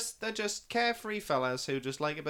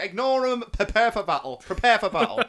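loud, raised-pitch mock battle order; Gandalf, commanding; 7 semitones higher, 11 dB louder than usual. Podcaster retells the wizard overruling the king, mock commanding shout. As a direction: call it out like an imitated commander rallying troops. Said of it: "And then Gandalf just comes and".

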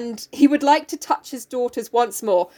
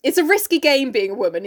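ordinary talk

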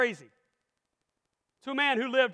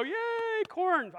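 mock cheer; 7 semitones higher, 5 dB quieter than usual. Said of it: "we all go".

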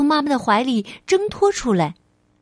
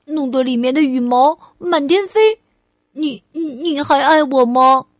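put-on louder, lively child voice; eager childlike questioning; little girl Ali, testing; 5 dB louder than usual. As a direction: play a small child posing a what-if. Child character asks mother.

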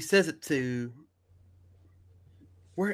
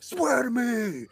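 slow, higher put-on demand; Batman; 8 semitones higher, much slower than usual. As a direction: stretch the order out in a hero voice. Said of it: "He's like".

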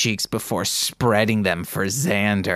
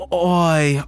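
high, hammed-up British exclamation; badly faked British accent; 6 semitones higher, 3 dB louder than usual. Reader voices a British character's moan, bad British accent. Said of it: "doing a bad job at any accent".

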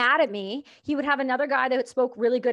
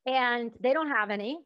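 ordinary talk

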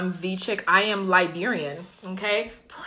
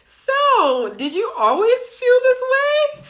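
much higher, louder mock question; high-pitched curious friend; 6 dB louder, slower than usual. Friend asking her about being queer, high-pitched imitation. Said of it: "She was like".

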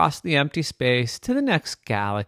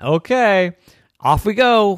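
loud, higher launch call; rousing send-off; 8 semitones higher, 8 dB louder than usual. Acted. Comet sets off with Pudding on its tail, rousing. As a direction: call it out as you take off.

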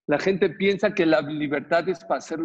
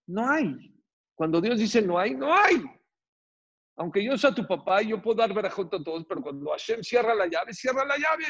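higher, insistent refusal building to a peak; emphatic refusal; 6 semitones higher than usual. Retold or imitated. Father tells daughter God has closed the door, emphatic retold refusal. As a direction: insist with rising pitch on each repeat.

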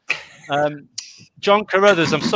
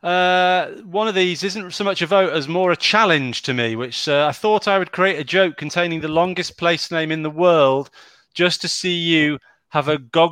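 normal talking voice

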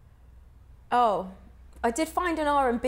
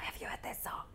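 conspiratorial whisper; 16 dB quieter than usual. Retold or imitated. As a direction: breathe it like a secret. Excited whisper to school friends.

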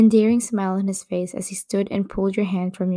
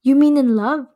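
higher, louder disbelieving retort; 6 semitones higher, 4 dB louder than usual. Acted. Jimin retorting to his girlfriend mid-fight, disbelieving. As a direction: throw it back at her. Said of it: "He retorted".

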